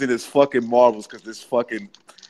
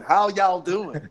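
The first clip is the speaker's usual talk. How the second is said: lightly mocked Southern US accent; Hillary Clinton; 5 semitones higher, much slower than usual. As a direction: toss off a politician's put-on Southern greeting. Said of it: "she goes to the South".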